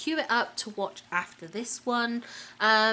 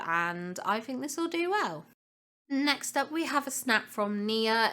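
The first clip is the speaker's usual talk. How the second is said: normal talking voice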